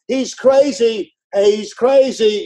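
high, drawn-out scoffing; mocking onlookers. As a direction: sneer it like a doubting crowd.

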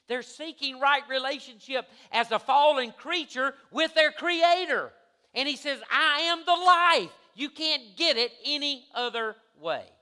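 loud, high-pitched preaching, hitting key words hard; full-force preaching. Real, not acted.

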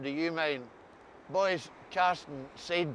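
normal talking voice

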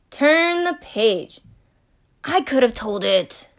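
ordinary delivery

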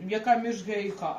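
ordinary talk

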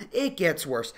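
ordinary delivery

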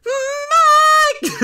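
piercing very high loud Mike; mock alarm yell. Commentator mimics the clip, very high loud shriek of Mike. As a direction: shriek it like a siren. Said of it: "it sounds like an alarm".

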